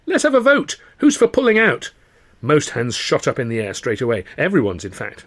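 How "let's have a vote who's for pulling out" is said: much higher, louder call to the room; rally everyone to a vote; shout. A narrator's reading. Tilly calling the troupe to a vote, shouting. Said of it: "Tilly suddenly shouted".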